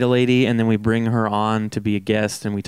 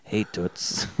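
low, soft sleazy greeting; greaser tough guy; 4 semitones lower, 10 dB quieter than usual. Pretend. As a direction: lean in like a smooth greaser. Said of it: "You're like".

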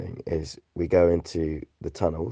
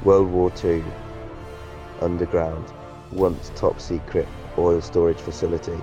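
movie trailer voice. Shown as a demonstration. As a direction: announce each phrase like a trailer. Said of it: "So here goes".

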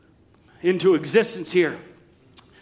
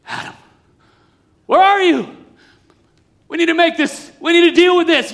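high, loud call, firm but loving; father calling out; 9 semitones higher, 6 dB louder than usual. Acted. God calling Adam to deal with sin, loud and high. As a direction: call him back to face it. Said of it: "That wasn't the response".

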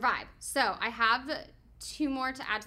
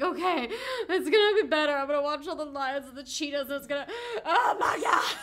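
high, laughing optimism breaking into a loud cry; mock hope turning horrified; 4 semitones higher, 5 dB louder than usual. Viewer expects relief from the next film, hope collapsing into mock horror. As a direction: start brightly reassured, end in comic horror.

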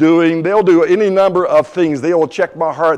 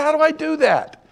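acted-out high-pitched bewilderment; helpless dismay; 12 semitones higher, 3 dB quieter than usual. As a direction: whine it like an overwhelmed patient. Retold dismay at dropping weight.

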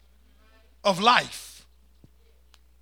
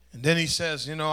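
normal talking voice

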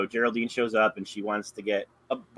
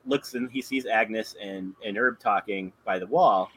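ordinary delivery

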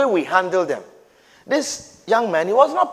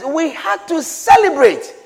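preaching shout; 7 semitones higher, 7 dB louder than usual. Real, not acted. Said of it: "preacher shouting the verse".

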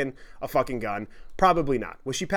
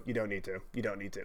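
much softer, low murmured pleading; 6 semitones lower, 13 dB quieter, faster than usual. Retold or imitated. Imitating the friend softly pleading behind the drunk girl. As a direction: murmur it from behind, barely heard.

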